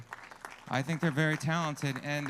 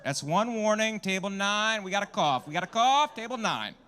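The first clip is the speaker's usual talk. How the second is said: higher and louder, like a PA alert; mock announcement; 7 semitones higher, 6 dB louder than usual. Comic announcing the coughing table like a loud mock alert. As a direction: sound the alarm about a cough.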